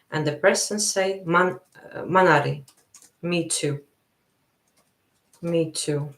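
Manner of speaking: ordinary talk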